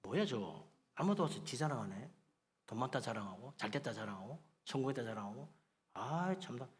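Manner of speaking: low, soft mock grumble; grumbling. Preacher voices hurt listeners grumbling about boaster, low mock grumble. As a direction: grumble under your breath about a show-off. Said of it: "마음에 상처를 받아".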